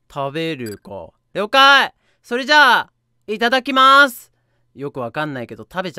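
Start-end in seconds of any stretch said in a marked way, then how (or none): 1.3-4.3 high, loud, gleeful monster before eating; eager creature. Acted. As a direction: announce the meal with hungry glee. (嬉しそうに食べる怪物の声)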